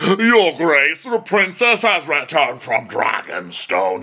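loud, slow herald's proclamation; hammed-up courtly announcement; announcing knight. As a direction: proclaim it grandly, drawn out. Knight announces arrival to king.

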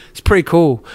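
normal talking voice

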